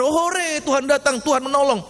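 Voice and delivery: high, light imagined hurrah; mock cheering. Preacher imagining disciples welcoming the Lord, mock cheering. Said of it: "apa mereka katakan".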